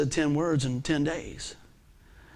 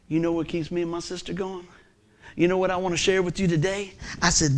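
usual speaking voice

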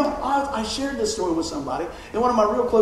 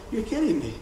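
incredulous; 6 semitones higher than usual. Retold or imitated. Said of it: "I was like".

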